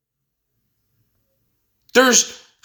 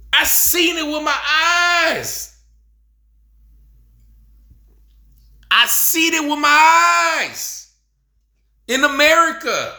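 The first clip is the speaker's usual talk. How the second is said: impassioned outcry; 6 semitones higher than usual. Real, not acted. Speaker testifying about teachers screaming at kids, impassioned outcry.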